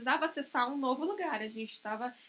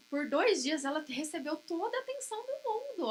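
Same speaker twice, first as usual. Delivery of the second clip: usual speaking voice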